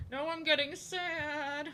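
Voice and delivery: high, slow pouty sad voice; mock sad whine. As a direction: pout it out. Repeating in a sadder voice.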